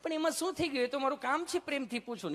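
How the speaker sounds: very high, sweet nurse voice; loving nurse. Retold or imitated. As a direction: answer him all sugar and innocence.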